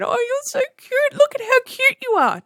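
put-on very high cooing baby talk. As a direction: coo at the chick like a baby. Baby talk to peacock chick.

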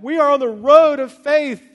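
loud, high rallying cry; excited believers. Acted. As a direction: proclaim it with hammed-up zeal. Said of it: "The Thessalonians are like".

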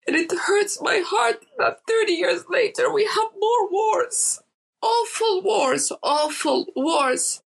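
high, strained, close to tears; fighting back tears over the wars; voice breaking with emotion. Real, not acted. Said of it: "I'm, like, so emotional".

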